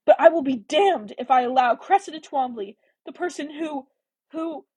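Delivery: high, heated, faltering at the end; emotional vow losing its words; trembling outrage. A narrator's reading. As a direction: swear it angrily, then break off. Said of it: "her entire body was trembling now".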